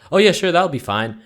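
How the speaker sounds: sudden high, loud cheerful snap; snapping switch. Retold or imitated. Customer suddenly switching to cheerful. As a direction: flip into a bright eager customer. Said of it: "He just snaps like".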